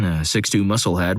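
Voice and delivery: usual speaking voice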